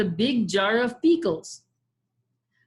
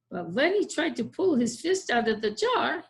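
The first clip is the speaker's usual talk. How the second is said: ordinary delivery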